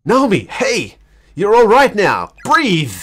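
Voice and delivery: a touch of urgent calling; urgent Satoshi. Acted. Satoshi rousing Naomi after the noose, urgent.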